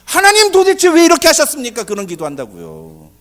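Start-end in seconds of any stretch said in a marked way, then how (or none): 0.0-1.8 loud, high cry of protest to God; anguished prayer. Acted. Elijah questions God over the dead boy, anguished loud prayer. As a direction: demand an answer from God at full voice. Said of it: "무슨 기도합니까?".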